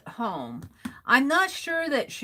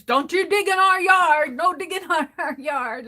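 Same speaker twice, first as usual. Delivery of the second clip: higher, louder mock scolding; retold yelling; 6 semitones higher, 4 dB louder than usual. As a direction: bark it at the neighbor kids. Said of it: "My brother was yelling at the kids".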